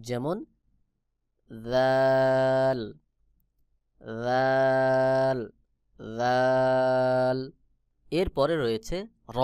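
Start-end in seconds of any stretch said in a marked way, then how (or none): 1.7-7.4 রাল as a long, loud "dhaal", tongue on teeth tips; tongue to teeth edges, stretch রাল loudly; letter demonstration. Shown as a demonstration. Teacher models Arabic dhal, রাল louder and very drawn out. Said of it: "জিহ্বার আগা সামনের উপরের বড় দুই দাঁতের আগার".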